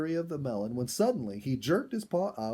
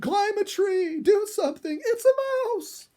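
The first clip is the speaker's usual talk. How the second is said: mother panicking over a little mouse; hysterical panic; 9 dB louder than usual. A narrator's reading. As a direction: beg in hammed-up terror. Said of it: "Dorothy cried".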